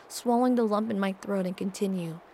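usual speaking voice

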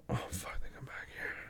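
very soft, airy murmur; breathless whisper. Acted. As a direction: whisper it on an exhale. Breathless moment with partner.

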